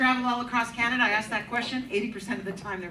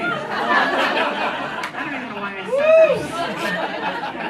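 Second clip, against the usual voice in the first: laughing; 10 semitones higher, 4 dB louder, much slower than usual. Real, not acted. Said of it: "I become Brett Forte all of a sudden".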